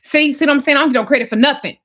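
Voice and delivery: imitating a partner's furious rant; retold angry outburst. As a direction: fire it off in an aggrieved rush. Retold quarrel with Damon.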